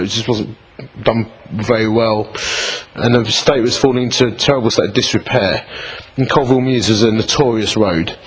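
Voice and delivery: ordinary delivery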